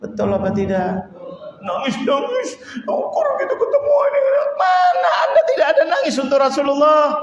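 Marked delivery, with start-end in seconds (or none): 1.6-7.2 high laughing cry; choked laugh on the edge of tears; laughing through tears. Real, not acted. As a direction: laugh and half-sob as the words spill out. Preacher laughing while crying out.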